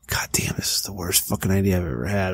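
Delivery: unvoiced, low whisper of regret; hushed inner voice of regret. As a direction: whisper it like a private thought. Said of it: "inside you're thinking".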